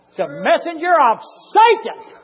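high, loud, drawn-out pulpit shout; full-force preaching. Real, not acted. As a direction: shout the scripture slowly to the congregation.

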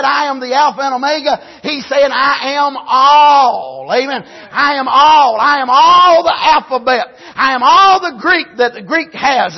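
preaching Jesus' words. Acted. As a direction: build it up and proclaim.

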